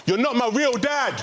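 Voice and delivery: slow, high-pitched mock scream; screamed protest. Son yelling through the wall at stepdad, screamed protest. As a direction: wail it like an outraged child. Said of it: "So I screamed out".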